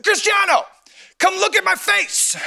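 high, drawn-out hollering; accented mock yell. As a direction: yell it like a cheering fan, accent and all. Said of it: "I'm gonna yell".

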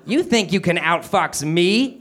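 high, drawn-out cartoon villain voice; eerie cartoon villain, stretched out; Krang. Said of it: "sounding eerily like Krang".

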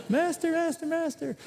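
high, whiny cry of master master; panicked servant. Retold or imitated. Servant who lost the axe head, high panicked wail. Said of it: "universal sign for".

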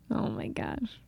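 breathless whispered disbelief. Real, not acted. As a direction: whisper it to yourself, amazed. Amused disbelief after laughing.

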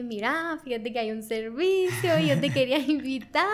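mother's coaxing invitation, breaking into laughter; laughing imitation. Daughter retells mom inviting her to church, laughing imitation.

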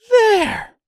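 high, loud angry cry. A narrator's reading. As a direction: cry out in anger. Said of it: "cried the milkmaid angrily".

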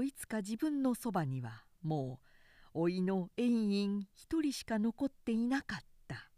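ordinary delivery

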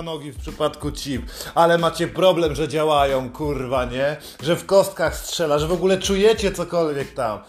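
ordinary talk

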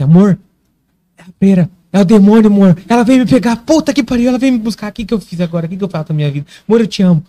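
high frightened pleading to partner; desperate, breathless terror; panicked. Retold or imitated. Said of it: "Eu virei pra Larissa e falei assim". (pânico desesperado)

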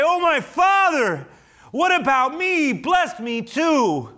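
high, loud, slow anguished plea; bitter cry. Acted. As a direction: cry out to your father in despair, dragging the words. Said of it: "he let out a loud and bitter cry".

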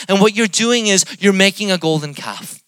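impassioned, high and loud sermon delivery; full-force preaching. Acted. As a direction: preach it out to the whole room.

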